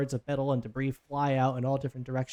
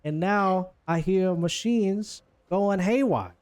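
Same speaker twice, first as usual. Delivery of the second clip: put-on higher, brash New Jersey accent; New Jersey housewife; 6 semitones higher, 3 dB louder than usual. Boss woman interrupted while cutting Leo's leg, irate New Jersey accent. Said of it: "like, a housewife of New Jersey".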